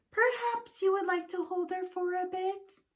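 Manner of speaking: lightly voiced wife, coaxing. Wife coaxing husband to hold piglet. Mrs Watson teasing.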